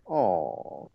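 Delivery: low, soft mock-dejected sigh of a word; retold disappointment. Acting out the robbed villain, mildly dejected. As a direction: let it sag, low and soft. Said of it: "and he's like".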